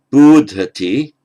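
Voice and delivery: bodhati, loud and long on BO; stress on first syllable of bodhati. Shown as a demonstration. Teacher showing how bud changes, first-syllable stress on bodhati. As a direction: hit the "bo" of bodhati slowly. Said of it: "stress on the first syllable".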